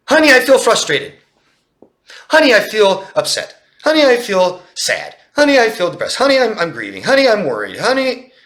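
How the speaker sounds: high, loud, pleading husband listing his feelings; high loud mock-plaintive husband going through his feelings; whiny husband. Husband voicing feelings to wife.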